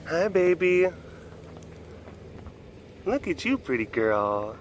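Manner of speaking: normal talking voice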